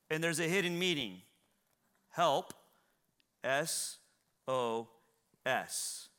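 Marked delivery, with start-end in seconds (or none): 2.1-6.1 "Help, S O S" one at a time, long pauses between; spelled out slowly. Shown as a demonstration. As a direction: separate each of "Help, S O S", dropping lower. Spells out the acrostic's letters.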